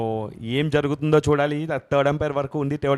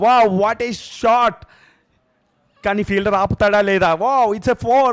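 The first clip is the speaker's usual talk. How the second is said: loud, high burst of excitement; excited cricket commentary; 4 semitones higher, 6 dB louder, much slower than usual. Real, not acted. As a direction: call the boundary with a roar. Boundary hit, commentator erupts. (ఉత్సాహంగా అరుపు)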